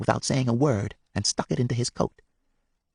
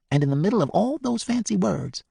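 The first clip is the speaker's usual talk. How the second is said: ordinary delivery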